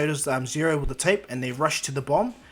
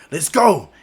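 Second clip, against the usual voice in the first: loud rallying shout; hammed-up charge cry; battle cry; 3 semitones higher, 12 dB louder than usual. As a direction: yell it to charge the team in. Acted battle cry to team.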